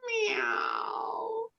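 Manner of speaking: high lazy cat; a high, whiny cat refusal. Acted. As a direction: mew it like a sleepy cat. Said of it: "said the cat".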